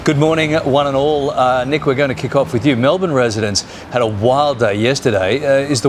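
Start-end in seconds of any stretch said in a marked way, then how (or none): none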